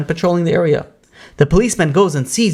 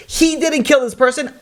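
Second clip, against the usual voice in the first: high-pitched impassioned retelling; acted-out desperate objection; urgent protest; 11 semitones higher than usual. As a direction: jump in to save a friend, voice raised high. Friend interrupts the hanging, protesting.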